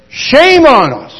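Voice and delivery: high, loud, slow rebuke. Real, not acted. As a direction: drive the shame home slowly and loudly. Preacher's rebuke to congregation.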